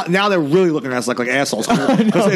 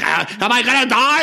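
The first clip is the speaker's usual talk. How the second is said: higher-pitched mock panic; hammed-up fear of dying; hyperventilating panic; 6 semitones higher than usual. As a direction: imitate a friend hyperventilating in fear. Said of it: "He's, like, hyperventilating".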